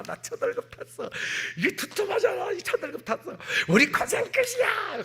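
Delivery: overjoyed son bursting in on his mother; breathless excited son. Retold or imitated. Son runs home with first pay. (어머니에게 첫 월급 자랑하는 아들, 들뜬 목소리)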